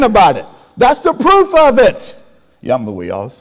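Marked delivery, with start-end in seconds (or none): 2.6-3.3 very low, softened boastful voice; mock braggart. Preacher mimicking someone bragging, low mock boast.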